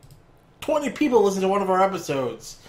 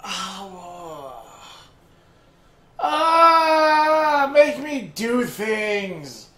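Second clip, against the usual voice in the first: loud fake wailing whine; hammed-up crybaby wail; mock crying; 11 semitones higher, 5 dB louder, much slower than usual. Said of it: "Doc, please stop. It's really annoying".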